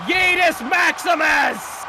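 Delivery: slow, high, roaring move call; wrestling commentator. Acted. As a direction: bellow the finisher's name. Calls the finishing move.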